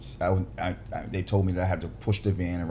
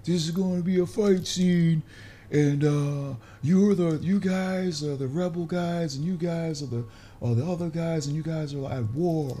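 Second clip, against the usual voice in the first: high, carrying megaphone call to the extras; mock film director shouting instructions through a megaphone; director on a blowhorn; 8 semitones higher than usual. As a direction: bark orders through a bullhorn across the set. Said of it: "Guys on a blowhorn".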